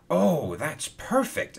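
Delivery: slow, high-pitched delight; pleased, lifted exclamation. Acted. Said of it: "exclaimed Hero".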